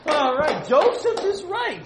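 high, loud, laughing praise; excited praise. Real, not acted. Youth leader cheering a kid's correct answer, excited and laughing.

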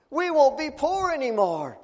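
high, loud cry of joy; joyful cry. Acted. Dad tells his kids the good news, high and loud with joy. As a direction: burst out with delight at the good news.

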